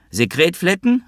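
high, rising indignant question; querying shout to another room; called out. A narrator's reading. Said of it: "rief Wowe in Richtung Küche".

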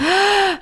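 sharp high gasp of joy; delighted gasp. Real, not acted. Hearing [name] opens the show, a thrilled gasp. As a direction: gasp in happy surprise. Hearing the support act news.

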